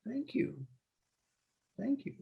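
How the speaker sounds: high, soft, sweet "Thank you, thank you". Shown as a demonstration. Driver thanking the passenger, soft and high. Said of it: "Did you say".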